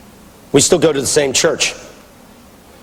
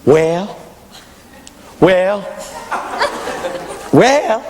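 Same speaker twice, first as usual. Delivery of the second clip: drawn-out approving response, rising each time; lightly voiced women's call from the pews; imitated church women; 2 semitones higher, much slower than usual. Women raise a hand to approve the preacher, lightly imitated. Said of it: "and they go".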